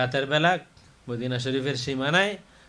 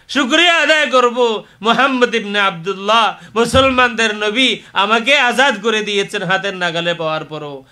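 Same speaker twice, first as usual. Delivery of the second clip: loud, raised retelling of a captive's vow; fervent, forceful sermon delivery; impassioned preaching; 7 semitones higher, 10 dB louder than usual. Retold or imitated. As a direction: declaim the pledge to the crowd.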